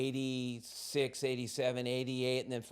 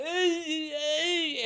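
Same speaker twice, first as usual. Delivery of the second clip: high, loud dazed hey; mock groggy waking call; 14 semitones higher, 4 dB louder than usual. Waking up drunk in the car, dazed.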